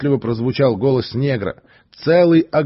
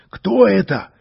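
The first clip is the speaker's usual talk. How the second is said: higher, louder excited question; eager question; 9 semitones higher, 5 dB louder than usual. Acted. An onlooker loudly demands who he is. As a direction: call out, eager to know. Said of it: "интересовались зрители".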